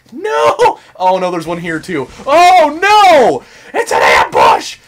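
very high, loud cries of alarm; panicked shout. Real, not acted. Player caught in an ambush, real panicked shouting. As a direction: shout it in escalating game panic.

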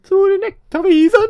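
high, loud rallying call; mock-heroic cry. Player announcing the next destination, loud mock-heroic cry. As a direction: belt it out like a silly battle cry.

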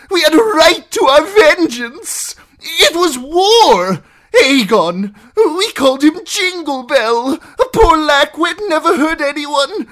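very high, loud impassioned tirade; shrill. A narrator's reading. Captive justifying the wedding to outlaws, shrill. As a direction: rant shrilly, pleading your case. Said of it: "His voice was shrill".

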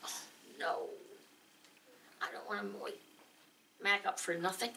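softly murmured dismay; under your breath, worried; hushed worry. Retold or imitated.

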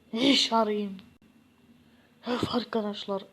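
ordinary talk

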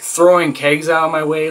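ordinary talk

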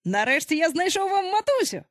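high-pitched proud announcement; excited exclamation. A narrator's reading. Peter tells boys he found a mother, excited. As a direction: announce it eagerly, pitch well up. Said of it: "вигукнув він".

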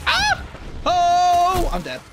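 sudden high shriek, then a loud groan; startled yelp. Real, not acted. Streamer killed mid-explanation, startled shriek. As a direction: cry out as the shot lands.

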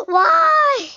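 high, loud drawn-out wail; wailed protest. Real, not acted. Gamer who just fell off, wailed protest. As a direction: cry it out high in dismay. Dismay after falling off.

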